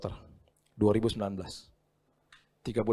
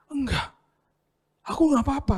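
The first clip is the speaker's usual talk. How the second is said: put-on much higher, child insisting he's fine; small boy's voice; 14 semitones higher, 5 dB louder than usual. Son telling his dad he is unhurt, high child voice. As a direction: answer like a shaken little boy.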